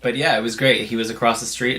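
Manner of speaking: usual speaking voice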